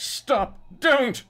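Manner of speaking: very high, loud plea; acted desperate wail. A narrator's reading. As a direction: cry out to stop the knife. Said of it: "Brendan wailed".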